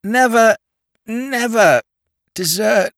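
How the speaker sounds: high, slurred outraged lord; indignant drunk toff. Acted. Drunk lord scolding Sugg for waking Parker, high and slurred. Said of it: "began Lord Peter".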